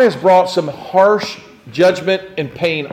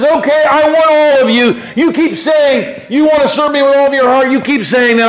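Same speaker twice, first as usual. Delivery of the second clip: loud, high-pitched demand; God; 9 semitones higher, 6 dB louder than usual. Acted. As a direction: confront them forcefully at full voice. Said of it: "and God says".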